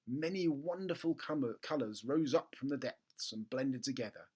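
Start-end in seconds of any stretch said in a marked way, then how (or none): none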